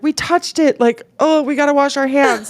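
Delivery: high, squeamish mock panic; retold grossed-out panic. Both women realise they touched it, squeamish high panic. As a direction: recoil in exaggerated disgust.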